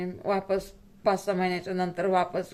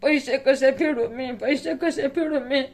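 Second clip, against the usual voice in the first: higher, crying plea; 6 semitones higher than usual. Real, not acted. Mother asking viewers how she will repay debts, crying out higher. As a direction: wail the question out in real distress. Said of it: "मी रात्रभर रडते".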